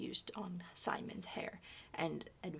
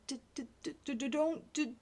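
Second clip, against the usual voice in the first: put-on higher child's voice, stuck on d-; boy retrying the sentence, stuttering; 6 semitones higher, 4 dB louder, faster than usual. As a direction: struggle through the stutter. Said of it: "attempted the sentence again".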